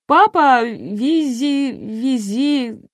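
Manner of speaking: высоким голосом, растерянно мямлить; запинаясь, не договаривая слова; мямля. A narrator's reading. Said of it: "замямлила девица". (мямлит высоким голоском)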